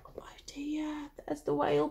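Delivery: hushed worried whisper. Acted. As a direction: whisper it anxiously. Whispered worry to children.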